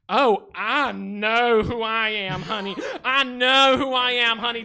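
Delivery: Western accent. Shown as a demonstration. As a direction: lay on a sassy Western accent. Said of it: "throw in a Western accent".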